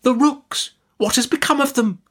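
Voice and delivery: very high, impatient exclamation; impatient Miss Betsy. A narrator's reading. Aunt presses the distracted mother about rooks, impatient. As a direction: press the point, pitched right up.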